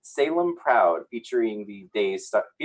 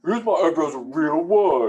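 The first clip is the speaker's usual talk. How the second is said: higher, louder laughing Eric impression; 5 semitones higher, 6 dB louder than usual. Cohost redoing the caption in an Eric voice, laughing. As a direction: ham up Eric defending his eyebrows. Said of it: "My Eric voice".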